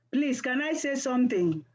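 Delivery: ordinary delivery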